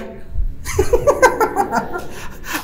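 a high unvoiced laugh, falling; breathy high laugh. Real, not acted. Laughing at the story. (tiếng cười hơi)

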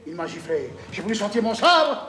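usual speaking voice

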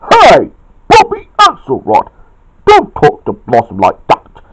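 high, loud call settling into a telling-off; scolding shout. A narrator's reading. Chuck sticks up for Blossom, scolding shout. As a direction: shout the name, then scold. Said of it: "shouted Chuck".

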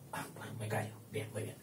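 very soft, deflated reply; meek backing down. Acted. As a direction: give in under your breath. Losing character backs down.

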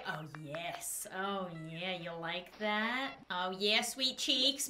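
low, soft teasing croon; mock flirty. Flirting with the villager to make him blush, low and soft. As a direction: purr it to charm him.

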